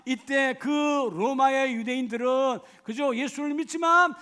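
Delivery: loud, high, fervent preaching; full-force preaching. Acted. Pastor pleading with the congregation, loud fervent preaching.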